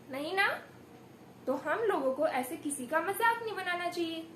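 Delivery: ordinary talk, nothing marked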